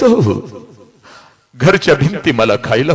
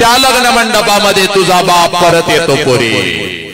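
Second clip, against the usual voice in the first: climactic preaching; 7 semitones higher than usual. Acted. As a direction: build to the reveal. (उंच आवाजात प्रवचन)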